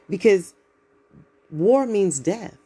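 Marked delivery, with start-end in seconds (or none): 1.5-2.6 war means death, slow and heavy, each word weighed; slow and grave. Real, not acted. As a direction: let it land slowly, grave and heavy. Solemn point about war.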